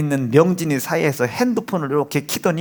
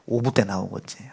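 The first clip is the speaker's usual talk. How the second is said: ordinary talk, nothing marked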